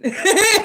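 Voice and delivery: a high, loud burst of laughter; loud laugh breaking through the reading. Real, not acted. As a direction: crack up at your own joke. Laughs at own teasing.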